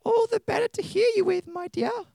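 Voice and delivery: put-on very high falsetto old granny. Wolf disguised as Granny answers the girl, falsetto granny voice. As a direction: squeak it out as a wolf faking Granny. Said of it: "in a granny voice".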